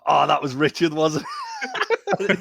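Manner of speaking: high laughing mock voice; mock realization. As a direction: play it as a giddy realization. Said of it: "Baz goes".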